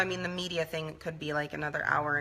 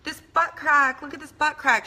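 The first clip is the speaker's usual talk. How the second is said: much higher, louder mock griping; imitating disgusted mother; 8 semitones higher, 7 dB louder than usual. Daughter mimics mother complaining about her butt, higher and louder. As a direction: squeal in disgust at her own body. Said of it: "she would say".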